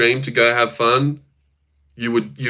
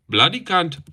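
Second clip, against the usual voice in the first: Indian accent attempt; 4 semitones higher than usual. Shown as a demonstration. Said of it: "Indian accent".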